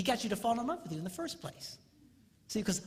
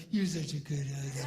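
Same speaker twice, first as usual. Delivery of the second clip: sweet wife voice; 6 semitones lower than usual. Retold or imitated. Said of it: "she goes".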